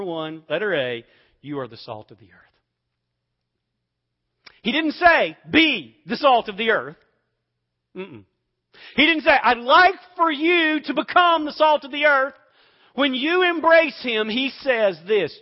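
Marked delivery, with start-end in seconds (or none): 4.6-15.4 loud, high, driving preacher's voice; big revival-style delivery; preaching at full force. Acted. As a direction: preach it hard to the congregation. Preaching contrast loudly.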